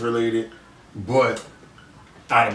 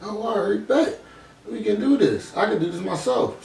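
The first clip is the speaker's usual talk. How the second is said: higher, bright sudden resolve, retold; retelling the moment he decided to start a podcast, mildly excited; excited thought; 9 semitones higher than usual. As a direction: voice his own thought jumping up in pitch. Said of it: "I'm like".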